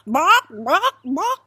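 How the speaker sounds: three loud, high rising clucks; high-pitched "bawk, bawk, bawk"; chicken squawks. Acted. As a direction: cluck three times like an insistent hen. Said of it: "The chicken nods".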